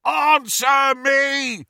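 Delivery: high, loud drawn-out roar; full-throated angry yell; furious bellow. A narrator's reading. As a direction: bellow it at a locked door, stretching the words. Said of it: "Amycus bellowed".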